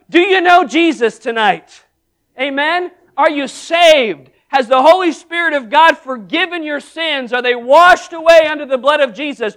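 fervent revival questions; fervent preaching. Real, not acted. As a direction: press the congregation with rising fervor. Altar call preaching.

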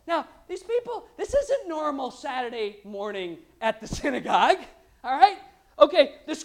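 normal talking voice

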